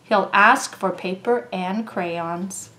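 ordinary delivery

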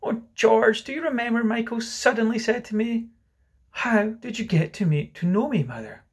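high-voiced tender reminiscence; wistful mother. Mother reminds George what Michael said, wistful and high. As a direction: recall it to your husband in a high voice. Mother fondly recalls lost child.

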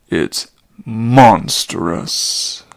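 low, slow, loud indignant cry. Acted. Old Chiron outraged at the mission, loud slow cry. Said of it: "cried Chiron indignantly".

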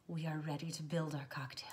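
soft whispered aside; hushed whisper. Real, not acted. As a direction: whisper it like a secret.

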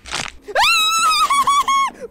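very high, sustained loud shriek; panicked shriek. Real, not acted. Player facing the cursed baby, high panicked shriek. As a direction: let out a long, piercing scream.

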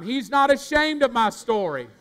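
not ashamed said high and loud; loud repeat. Shown as a demonstration. Preacher leads the crowd's repeat, loud. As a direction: belt it back to them. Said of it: "one more time".

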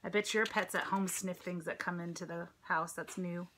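plain reading voice

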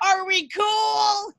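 high, slow, wailing mock-sob of a panicked drunk girl; hammed-up hysterical wail, dragged out; hysterical crying. Drunk friend begging the cop.

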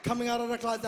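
ordinary talk

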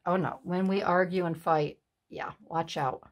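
ordinary talk

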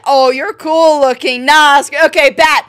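ordinary delivery

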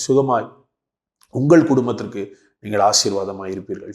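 ordinary talk, nothing marked

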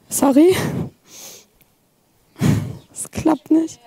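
normal talking voice

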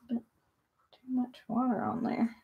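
slow whispered self-talk; softly whispered muttering. Real, not acted. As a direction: whisper it to yourself while concentrating. Painting, muttering to herself.

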